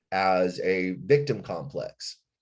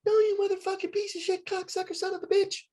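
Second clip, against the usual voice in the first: much higher, squeaky mock rant; mocking bandmate; faster than usual. Singer mocking guitarist cursing him out, much higher mock voice. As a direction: lampoon him as a shrill cursing brat. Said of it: "And he's like".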